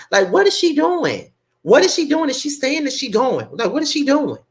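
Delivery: high, disbelieving questions; incredulous. Real, not acted. Repeats the question, much higher.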